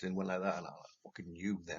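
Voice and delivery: ordinary delivery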